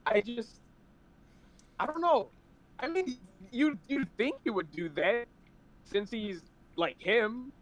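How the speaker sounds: muffled bad mic; underwater. Real, not acted. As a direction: sounds like under water. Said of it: "you're sounding like you're underwater right now".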